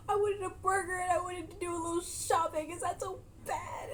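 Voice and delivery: high whiny pleading to God; mock whiny lament. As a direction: complain like the day was ruined. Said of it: "in her mind asking".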